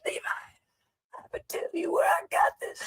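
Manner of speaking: strained whispery rasp, no voice; mimicking a voiceless character voice; damaged vocal cords. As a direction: squeeze it out through ruined vocal cords. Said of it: "talking like this the whole time".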